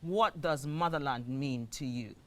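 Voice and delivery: ordinary talk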